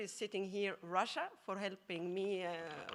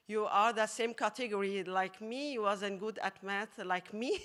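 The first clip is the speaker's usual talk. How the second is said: ordinary delivery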